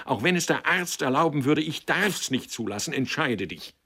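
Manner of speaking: high, agitated demand; vehement, urgent insistence; cried out. A narrator's reading. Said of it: "rief sie".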